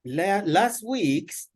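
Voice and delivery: last said slowly and high, punched; stress on last. Shown as a demonstration. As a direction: lean hard on last, drawn out. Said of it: "Another example would be".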